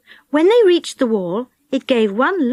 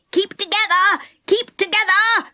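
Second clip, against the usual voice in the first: urgent calling out; 8 semitones higher than usual. A narrator's reading. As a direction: call to the group to hold on. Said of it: "called Moonface".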